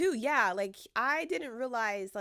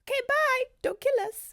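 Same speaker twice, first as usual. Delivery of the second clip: high, pitched-up imitation of tiny talk; 12 semitones higher, 5 dB louder than usual. Friend retelling the voodoo doll joke, imitated tiny voice. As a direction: squeeze it up into a mock-tiny voice. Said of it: "it was in tiny".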